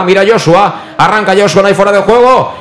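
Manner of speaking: breakneck goal commentary. Real, not acted. Goal chance build-up call.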